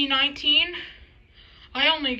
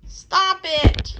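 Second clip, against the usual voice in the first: louder, higher protest; exclaim; 6 semitones higher, 6 dB louder than usual. Acted. Monster protesting the crew's teasing, loud mock protest.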